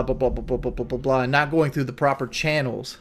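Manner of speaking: normal talking voice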